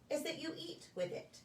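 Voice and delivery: plain reading voice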